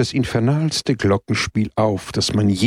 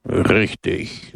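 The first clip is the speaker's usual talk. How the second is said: gloomy agreement. Acted. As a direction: grimly concede the point. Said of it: "Pepone nickte düster".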